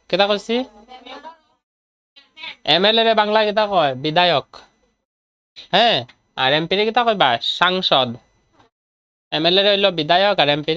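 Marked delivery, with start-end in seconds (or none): none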